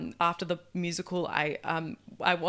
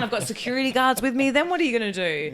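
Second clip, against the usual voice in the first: mock diva; 9 dB louder than usual. Joking about future fame.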